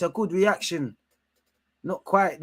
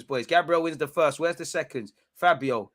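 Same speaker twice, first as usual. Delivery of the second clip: usual speaking voice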